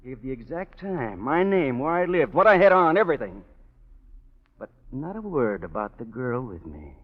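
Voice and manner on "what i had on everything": heated. Acted. Gangster listing evidence, heated.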